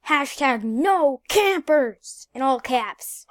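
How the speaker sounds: slow. Real, not acted. Slow emphatic chat request.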